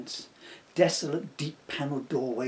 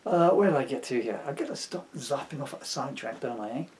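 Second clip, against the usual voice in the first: normal talking voice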